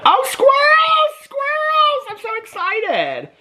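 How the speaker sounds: very high, slow squealing cry of joy; squealing with real delight over the squirrel page; delighted shriek. Real, not acted. Finds squirrels in book.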